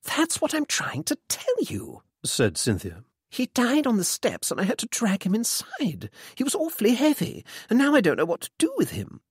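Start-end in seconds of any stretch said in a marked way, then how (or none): 0.0-2.0 very high, exasperated undertone; hushed exasperation. A narrator's reading. Exasperated mother to her son. 3.3-9.2 high, rushed, half-whispered voice; flustered mother, helpless. A narrator's reading. Mother tells her son about the body, flustered.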